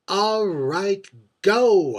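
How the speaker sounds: loud, high shout of all right, go; shouted dismissal. Retold or imitated. Shouting at the houseboy to go, loud and high. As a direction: fling the words at him, loud and high. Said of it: "I said".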